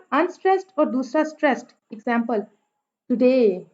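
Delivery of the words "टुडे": टुडे with light टु and long, strong डे; iambic stress. Shown as a demonstration. Teacher gives iambic example, second syllable of टुडे stressed. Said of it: "डे पे stress है".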